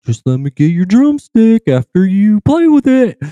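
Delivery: high, loud begging voice; pleading fan. Retold or imitated. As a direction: beg the drummer like an eager kid. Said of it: "I was like".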